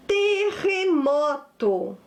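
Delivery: "Terremoto" drawn out syllable by syllable; slow dictation. Shown as a demonstration. Teacher presents a new word to pupils, slow dictation. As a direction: stretch each syllable of "Terremoto".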